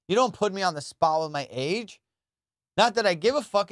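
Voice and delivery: normal talking voice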